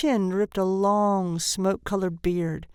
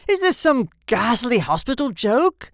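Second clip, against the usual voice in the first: loud, higher, indignant demand; terrified rage; 4 semitones higher, 7 dB louder than usual. A narrator's reading. Shocked father accuses the nurse, loud and enraged. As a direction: thunder it in rising outrage. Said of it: "his terror resolving into rage".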